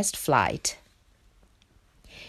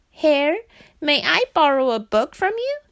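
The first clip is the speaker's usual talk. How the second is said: high, louder frog voice; frog character; 8 semitones higher, 5 dB louder than usual. A narrator's reading. Frog asks Hare a favour.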